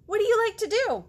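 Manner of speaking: put-on eager kid. Girl in the book quizzing the potato, high and fast. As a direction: bounce with eagerness. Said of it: "girl to potato".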